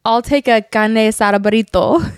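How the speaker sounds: overdone Spanish accent that lands as Italian; bad Spanish accent. Shown as a demonstration. Showing partner her Spanish, badly faked accent. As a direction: tap the r's in a Spanish-Italian muddle. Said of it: "that was Italian with".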